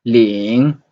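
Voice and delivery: Ling in Mandarin second tone, dipping then rising. Shown as a demonstration. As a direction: let Ling climb at the end like a question. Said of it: "the second tone".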